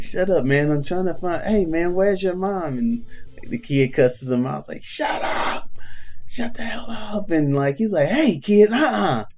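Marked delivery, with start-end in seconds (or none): 0.0-2.8 higher-pitched, irritated retort; annoyed buddy. Retold or imitated. Friend searching for a kid's parent, annoyed. 4.8-7.3 put-on high, strained kid's shout; mock bratty yell. Kid cussing out a stranger at the mall, mock yell. 8.1-9.2 put-on high-pitched warning to a child; mock scolding. Buddy to a cussing kid, mock scolding.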